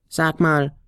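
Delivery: hohe Flötenstimme; Flöte. A narrator's reading. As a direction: kleine Flöte fragt neugierig. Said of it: "begann die Flöte". (hohe, neugierige Flötenstimme)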